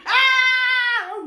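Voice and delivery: sharp high yelp of a cry; a startled high shout; loud cry. Real, not acted. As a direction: let out a sudden piercing cry. Sudden outburst mid-chat.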